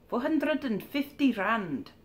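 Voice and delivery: put-on slow, drawn-out South African accent. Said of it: "that's my terrible South African accent".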